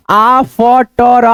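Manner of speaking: "after" split slowly as aa-f-ta-ra; broken into sounds. Shown as a demonstration. Teacher breaks down after for learners, piece by piece. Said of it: "इसको क्या पढ़ेंगे".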